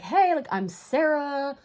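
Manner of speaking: normal talking voice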